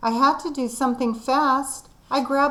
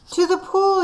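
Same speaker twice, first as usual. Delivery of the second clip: high, loud yell; 8 semitones higher, 4 dB louder than usual. Acted. Firemen shouting the way to the pool, loud yell. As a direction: shout it out to the crowd. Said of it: "yelled the firemen".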